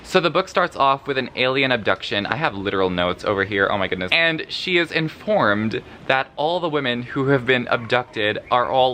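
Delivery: usual speaking voice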